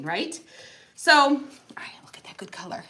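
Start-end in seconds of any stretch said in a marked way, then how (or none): 1.8-2.8 quick breathy aside under the breath; hushed admiring whisper. Real, not acted. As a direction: murmur it to yourself as you paint. Aside about her painting.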